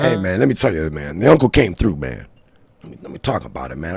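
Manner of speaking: low, gentle mock reporter voice. Friend spoofing the reporter, low and gentle. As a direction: lightly put on a low gentle voice. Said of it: "I got this gentle voice".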